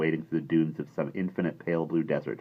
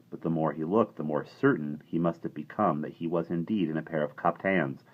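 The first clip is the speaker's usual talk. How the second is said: ordinary delivery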